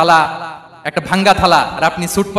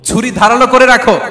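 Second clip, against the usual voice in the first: raised, louder instruction; forceful command; 5 semitones higher, 5 dB louder than usual. Retold or imitated. Preacher voicing the Prophet's order on slaughter, forceful command. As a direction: order it emphatically.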